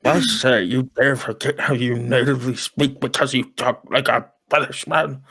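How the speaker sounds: put-on blustering old British gentleman act; fake elderly British man. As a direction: sputter it as a hammed-up old Brit. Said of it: "pretending to be a really elderly British man".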